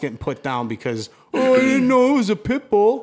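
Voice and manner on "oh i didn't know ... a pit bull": high, loud, dopey put-on voice; mock dumb bystander. Mocking the nitwit whose hand got bitten, in a high dopey voice. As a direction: play him as a clueless idiot. Mocking a careless dog-hater.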